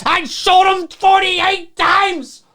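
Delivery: high, slow, shouted crazed gunman; hammed-up Loomis. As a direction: yell it like a madman emptying his gun. Acting Loomis shooting Michael.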